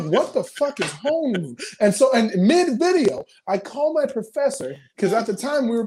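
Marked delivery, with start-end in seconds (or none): 0.0-1.6 higher-pitched, incredulous retold question; mock bewilderment, pitched up; baffled. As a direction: demand to know what this nonsense word means. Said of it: "that fucked my brain".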